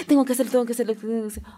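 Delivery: acted-out haste, words tumbling over each other; rushed, hurried. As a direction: rattle it off as if in a great rush. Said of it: "por la prisa de".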